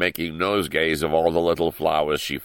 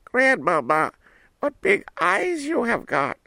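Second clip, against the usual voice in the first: put-on little girl voice. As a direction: ask like an astonished small girl. Girl questions.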